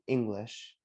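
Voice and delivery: "English" with a short first vowel; "English" said evenly for repeating; pronunciation model. Shown as a demonstration. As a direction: lead the class in "English" as "ING-glish". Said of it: "say it with me".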